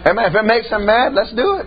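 normal talking voice

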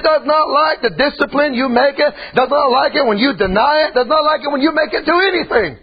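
high, emphatic sermon line; preaching. Acted. Preacher warning the church about the flesh. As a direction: press each does not.

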